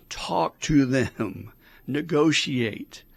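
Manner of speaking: ordinary delivery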